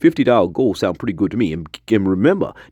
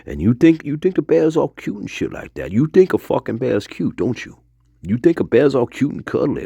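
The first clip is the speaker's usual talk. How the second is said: higher-pitched mocking challenge; 7 semitones higher than usual. Storyteller scoffing at whoever finds bears cute, mocking. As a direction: tease the listener with a raised pitch. Mocks listener's idea of bears.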